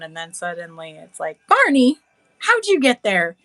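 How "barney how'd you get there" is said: mock surprise. Acted surprised call.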